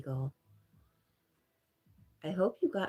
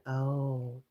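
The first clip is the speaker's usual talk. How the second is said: ordinary talk, nothing marked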